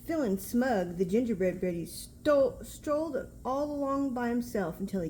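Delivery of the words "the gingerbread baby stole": plain reading voice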